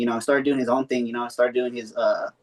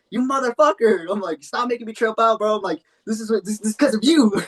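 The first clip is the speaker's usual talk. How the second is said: mock accusing; 8 semitones higher than usual. Blaming friend for tripping.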